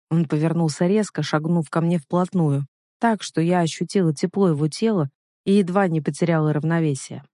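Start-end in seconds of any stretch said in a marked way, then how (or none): none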